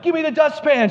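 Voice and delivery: high-pitched frantic order; urgent demand. Retold or imitated. Husband pinning a mouse calls to his wife, urgently. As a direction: re-enact a panicked request for help.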